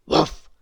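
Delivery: short, loud woof; dog bark. Acted. Sheepdog in the farmyard tangle, barking. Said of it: "barked the sheepdog".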